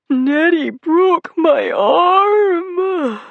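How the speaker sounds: very high, loud, slow sobbing wail. Acted. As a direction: howl the accusation like a hurt child. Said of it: "he wailed".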